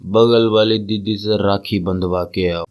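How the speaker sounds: low, slow paternal instruction; father's voice. Acted. Father sends son to the neighbour girl, deep and slow. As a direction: drop deep and draw it out like a father. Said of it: "तुम्हारा बाप तुम्हें सौ की पत्ती पकड़ा के बोलता है".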